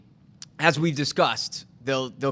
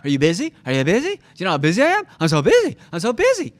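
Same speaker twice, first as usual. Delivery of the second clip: loud, boastful mock brag; cocky agent; 2 semitones higher, 5 dB louder, faster than usual. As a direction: brag loudly about being so busy. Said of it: "very cocky".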